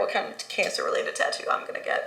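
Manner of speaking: soft unvoiced aside; whispering. Real, not acted. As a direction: whisper it under your breath. Aside about camera.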